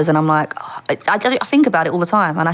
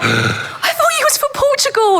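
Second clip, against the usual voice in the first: much higher, slow, wailing disbelief; stunned disbelief. Retold or imitated. Realising the officer was from Haringey, high and disbelieving. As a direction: sound utterly let down. Shocked at being fooled.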